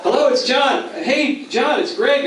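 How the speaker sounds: high, sing-out hello on the phone; mock phone greeting. Two rivals greet on an early call, both high and chipper.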